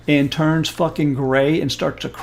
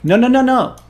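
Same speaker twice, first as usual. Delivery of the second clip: excited no; 9 semitones higher, 6 dB louder than usual. Real, not acted. Building to worse movie scene.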